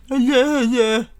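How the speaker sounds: mock the haters, loud and high; taunting impression of haters. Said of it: "That's an impression of, uh, you know, my haters".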